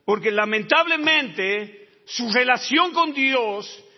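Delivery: loud, high, slowed preaching cry; preacher at full force; fiery preaching. Acted. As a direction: hammer each phrase to the congregation.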